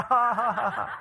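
high, loud mock cackle; exaggerated ha-ha laugh; imitated child's laughter. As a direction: laugh along like a tickled kid. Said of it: "he starts laughing".

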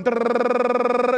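long rolled r like a racing motor; mock brain whirring. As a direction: make the sound of a brain spinning. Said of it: "And brain started going".

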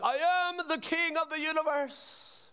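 high, slow, far-off madman proclaiming; hammed-up deluded king shout; madman screaming. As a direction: mock-scream like a deluded man on a chair. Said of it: "And Harry's like".

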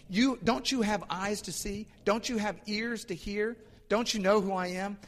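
exasperated high-pitched rebuke, acted out; cutting loose. Preacher voices Jesus rebuking disciples in the boat, exasperated. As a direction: imitate a teacher scolding, question after question. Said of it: "just cut loose on him".

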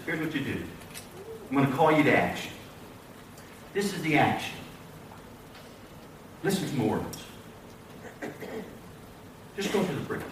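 low, soft-spoken directive; calm instruction. Acted. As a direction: give the instruction quietly, with pauses between sentences. Preacher voices Jesus calmly instructing.

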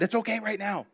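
high-pitched, unconvinced reassurance; uneasy reassurance. Shown as a demonstration. Preacher showing congregation a peace that doesn't feel okay, uneasy reassurance. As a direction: say all is well as if waiting for trouble. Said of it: "look at the way I say that".

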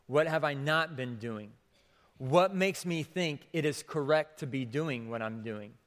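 ordinary talk

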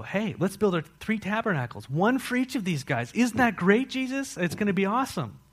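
high, excited gushing; bubbly, high-pitched eagerness; eager Peter. Acted. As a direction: pitch a big idea with naive enthusiasm. Acting out over-eager Peter at Transfiguration.

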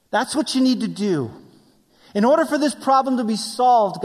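high, emphatic acted plea; insistent demanding. Acting David's demands to God.

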